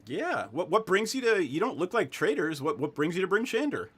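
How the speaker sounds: lightly voiced deputy, lilting; curious friendly deputy. Deputy asks strangers why they came, lightly voiced and curious. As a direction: question the strangers with a light lilt. Game master plays the deputy.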